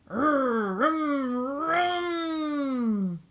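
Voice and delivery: high, loud engine vroom. Acted. Backhoe spinning out of the muck, loud vroom. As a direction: rev it like a big engine.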